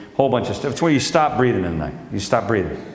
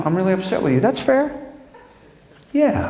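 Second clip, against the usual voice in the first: higher, sing-song wife impression; mock wife; 9 semitones higher than usual. Comedian mocking his wife in a high voice. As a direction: mimic her tidy complaint. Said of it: "which is good communication".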